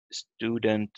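"student" stressed on STU, as in English; English first-syllable stress. Shown as a demonstration. Teacher gives an English example, "student" stressed at the start. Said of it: "with stress on the first letter".

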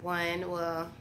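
raspy, soft just-woke-up voice; sleepy voice. Real, not acted. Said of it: "I still have the sleepy voice".

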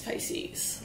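breathy whisper of the name. Real, not acted. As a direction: whisper it softly, no voice. Softly addressing viewers.

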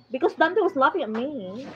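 ordinary delivery

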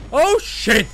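ordinary talk

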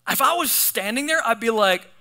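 ordinary talk, nothing marked

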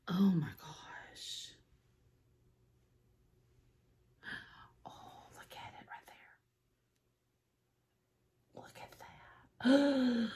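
breathless whisper of wonder; hushed, slow whisper over something gorgeous; awed whisper. Real, not acted. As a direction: whisper it like you can't believe your eyes. Awed by eyeshadow palette.

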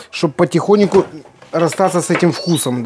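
usual speaking voice

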